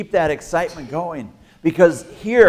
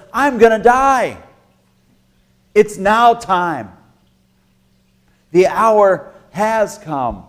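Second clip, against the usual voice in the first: Jesus speaking; 6 semitones higher, 6 dB louder, slower than usual. Acted. Preacher voices Jesus' words.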